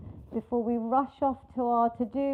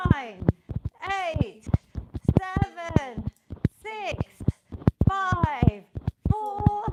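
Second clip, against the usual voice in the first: energetic countdown; 3 semitones higher, 3 dB louder, slower than usual. Real, not acted. Coach counting down last sprint.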